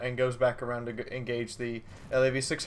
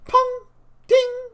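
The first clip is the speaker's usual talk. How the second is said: sound effect voice; 9 dB louder than usual. Retold or imitated. Imitating shell hit sounds.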